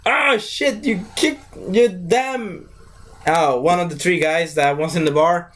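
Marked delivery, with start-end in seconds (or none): 0.1-2.7 high panicked yelp; high startled yelp. Retold or imitated. Second thug yelps high in shock. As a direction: yelp at a friend's injury. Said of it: "the other guy's like".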